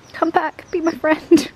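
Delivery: coaxing a cat, high and sweet; high pleading cat call. Real, not acted. Calling to a cat again.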